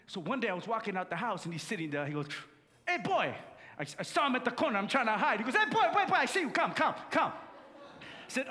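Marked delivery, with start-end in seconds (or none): 2.9-3.3 high, loud call across the yard. Retold or imitated. Uncle calling out to boy. 5.5-7.3 high, loud summons with a laugh; hollering for the nephew to come over; uncle calling out. Retold or imitated.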